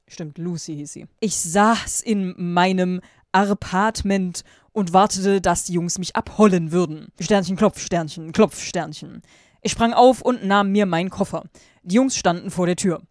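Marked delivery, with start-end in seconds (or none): 1.2-13.0 louder, emphatic read-aloud delivery; story narration. A narrator's reading. Reading fan fiction aloud to viewers, emphatic narration. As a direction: read it out with loud, deliberate emphasis.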